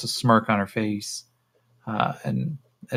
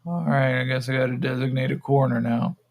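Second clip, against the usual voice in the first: loose, woozy character voice; dazed resignation; 3 semitones higher than usual. Acted. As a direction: give in vaguely, as the stoned captive. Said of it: "He goes".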